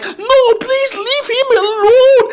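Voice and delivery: high, pleading mother voice. Pretend. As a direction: beg her to leave the kid alone. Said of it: "your mother will be like".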